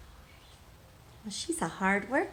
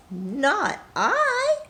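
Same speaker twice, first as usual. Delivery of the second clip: cat voice; 9 semitones higher, 4 dB louder than usual. Acted. Cat's refusal in the story.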